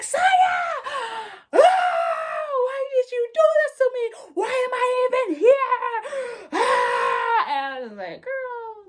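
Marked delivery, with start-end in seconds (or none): 0.0-7.4 high, loud wailing scream; crashing out. Retold or imitated. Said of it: "Red crashes the fuck out". 8.2-8.8 high-pitched, drawn-out mock disbelief; stunned reaction. Stunned friend reacting to outburst.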